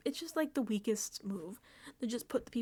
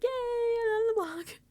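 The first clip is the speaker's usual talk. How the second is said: much higher, slow mock glee; sarcastic cheer; 13 semitones higher, 4 dB louder, slower than usual. [name] pretending to be thrilled she's nominated, in sarcastic higher glee. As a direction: fake delight, dripping irony.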